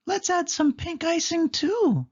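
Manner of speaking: very high squeaky mouse voice; sweet high chirp; Minnie voice. A narrator's reading. Minnie adds icing, high squeaky voice. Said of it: "said Minnie".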